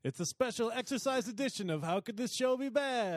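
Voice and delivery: high, pumped-up show intro; hyped announcer. Acted. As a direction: announce it like a fitness hype man. Hyped exercise edition intro.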